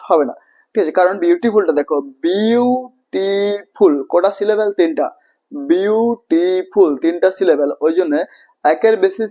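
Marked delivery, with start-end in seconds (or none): 2.3-3.9 বিউটিফুল split into three drawn-out syllables. Shown as a demonstration. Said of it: "ভেঙে ভেঙে পড়তে হচ্ছে". 5.5-6.9 বিউটিফুল slowly broken into three syllables. Shown as a demonstration. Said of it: "ভেঙে ভেঙে পড়তে হচ্ছে".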